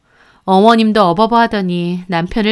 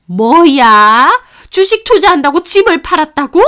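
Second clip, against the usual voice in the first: outraged disbelief; 6 semitones higher, 6 dB louder than usual. A narrator's reading. Mother-in-law outraged her son sold house for stocks, disbelief. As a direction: let it sink in, then explode.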